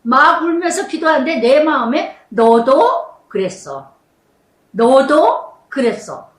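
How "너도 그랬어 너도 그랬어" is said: slow, gentle rebuke spoken from within; slow inner voice gently convicting; voice of the Spirit. Retold or imitated.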